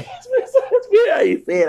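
high laugh breaking through the words. Real, not acted. Laughing at how he phrased it to viewers, high and giggly. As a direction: laugh mid-sentence at your own wording.

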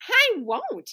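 yelled refusal. A narrator's reading. Boy refuses his hostess's cookie, mildly yelling. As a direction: refuse in a high, contained yell. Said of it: "yelled Howie".

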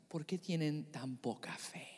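hushed, soft unvoiced question; soft whispered rebuke. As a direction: whisper it gently as a calm rebuke. Jesus gently rebuking.

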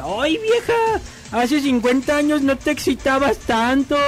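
high swooping "Ay", then easing down; excited old man. Acted. Old man marvels at his wife, excited. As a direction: exclaim it like a thrilled old husband. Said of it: "el viejo todo emocionado le dice".

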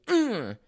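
high, loud mock strain grunt; heavy lifting grunt. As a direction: grunt as if heaving a huge weight. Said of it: "that's when you grunt".

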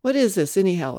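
loud, impatient demand. A narrator's reading. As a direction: insist on knowing. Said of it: "I demanded".

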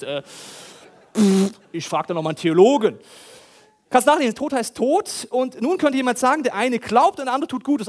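ordinary talk, nothing marked

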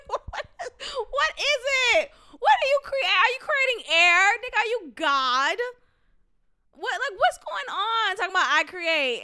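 loud, high, disbelieving questions fired off; incredulous ranting. Real, not acted. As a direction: grill him in mock outrage, laughing at it. Mock interrogation of the guy.

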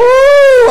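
high loud whoop of joy; loud joyful whoop; joyful woo. Real, not acted. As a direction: let out a big excited shout. Preacher shouts joyfully.

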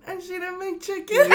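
high, loud wail dissolving into laughter; real tearful outburst; crying. Real, not acted. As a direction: break down in tears and helpless laughs. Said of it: "she's crying right now".

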